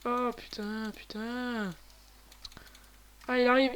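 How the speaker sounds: usual speaking voice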